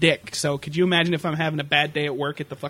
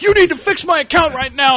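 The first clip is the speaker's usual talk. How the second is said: higher, louder demanding caller; mock angry customer; 10 semitones higher, 6 dB louder than usual. Angry caller yelling at the agent, mock angry. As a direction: bark the demand. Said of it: "And they're like".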